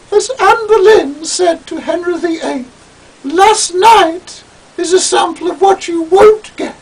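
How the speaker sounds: very high, loud arch retort; Julian's prim quip. Acted. Julian quipping back at Toad, very high and arch. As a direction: deliver the put-down archly.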